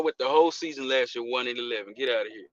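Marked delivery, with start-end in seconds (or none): none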